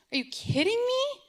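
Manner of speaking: high, far-up incredulous cry; stunned, almost squeaking; shocked disbelief. Retold or imitated. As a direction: mom reacting to counselor's pronoun plan in disbelief. Mom shocked at counselor.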